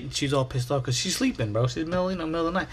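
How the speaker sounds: normal talking voice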